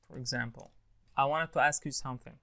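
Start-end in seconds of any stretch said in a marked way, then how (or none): 1.2-2.4 "wanted" as "wanned", running into "to"; casual connected speech. Shown as a demonstration. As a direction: drop the T in "wanted" and link it to "to". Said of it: "بيقولها".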